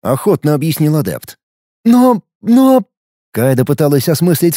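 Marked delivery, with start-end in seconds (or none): 1.8-2.8 high, loud shocked stammer; stammering woman. Acted. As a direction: she can't find the words.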